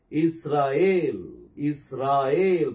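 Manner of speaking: இஸ்ராயேல் very slow, each syllable drawn out; exaggerated pronunciation. Shown as a demonstration. Teacher joining the letters for students, exaggerated pronunciation. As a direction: stretch இஸ்ராயேல் out as is-raa-yel. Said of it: "நான் அதை அதிகமா தான் உச்சரிக்கிறேன்".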